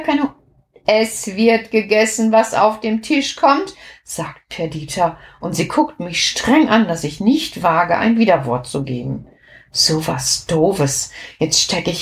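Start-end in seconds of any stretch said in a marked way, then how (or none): none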